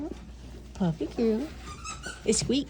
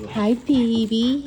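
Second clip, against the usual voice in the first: drawn-out, louder loving greeting; slow loud coo; 7 dB louder, much slower than usual. Real, not acted. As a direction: stretch it out like greeting a beloved pet. Greeting the dog fondly.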